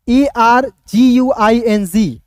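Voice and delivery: letters spelled out slowly, one by one; slow, deliberate dictation of each letter; slow spelling. Shown as a demonstration. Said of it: "ए आर जी यू को ई डी लिखा".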